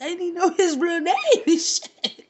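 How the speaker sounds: high and laughing through it; laughing disbelief. Real, not acted. Laughing while admitting she didn't know his real name.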